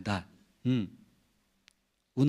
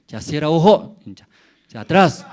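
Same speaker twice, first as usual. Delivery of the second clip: louder commanding father; stern father order; 5 semitones higher, 12 dB louder than usual. Retold or imitated. Father ordering son before punishment, loud stern command. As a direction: order the child to turn around.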